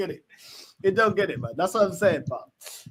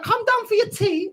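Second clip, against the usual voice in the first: high-pitched Scarborough accent impression; 12 semitones higher, 3 dB louder than usual. Retelling how a family friend called for tea, high Scarborough accent. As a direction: mimic a Scarborough woman calling out, high. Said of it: "She's like".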